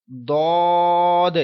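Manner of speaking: دود held long in a full heavy voice; teacher recites heavy دود slowly; full heavy letter. Shown as a demonstration. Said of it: "پورا آواز میں پڑھیں گے".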